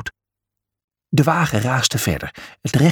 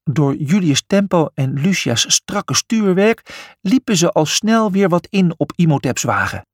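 ordinary delivery, nothing marked

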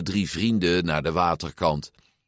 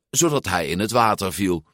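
plain reading voice

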